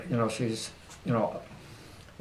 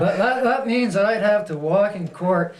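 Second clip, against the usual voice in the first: high, loud, laughing delivery; 9 semitones higher, 4 dB louder than usual. Real, not acted. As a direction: crack up while picturing it. Laughing over imagined shackles in court.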